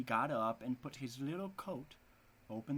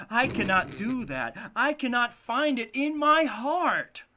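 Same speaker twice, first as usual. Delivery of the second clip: pleading father; 6 semitones higher, 9 dB louder than usual. Acted. The father pleads for his children, loud and high.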